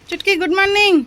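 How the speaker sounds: high, loud sing-song good morning; wake-up call. Real, not acted. Mother waking her daughter Chutki, high and loud. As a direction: chirp her awake, bright and loud.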